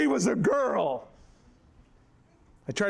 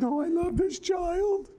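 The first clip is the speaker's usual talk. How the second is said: high, slow wife's voice; mock high-pitched doting mother; imitating wife; 10 semitones higher, slower than usual. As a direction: mimic her doting on the baby. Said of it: "She said".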